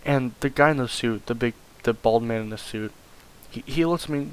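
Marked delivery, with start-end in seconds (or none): none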